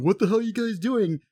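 high, exasperated mock outcry; mock indignation. Bystanders scolding the clowning brothers, mock indignant. Acting out bystanders ruining the moment.

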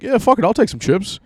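a put-on voice shrugging it off; homeless man giving in. Homeless man accepting chips.

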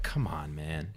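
low, soft, exasperated aside; imitated weary protest. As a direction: plead quietly as the put-upon translator. Imitated translator pleading wearily with reporter.

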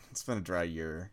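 embarrassed whisper. Real, not acted. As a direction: mutter it under your breath, ashamed.

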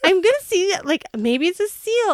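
high, excited mock alarm; retold excitement. Wife flailing on the boat over a possible seal, retold excitement. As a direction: pitch it up like a flustered sighting.

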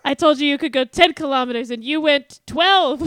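hammed-up stern leader scolding the public; mock scolding president. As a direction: tell them off like a fed-up president. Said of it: "he's like".

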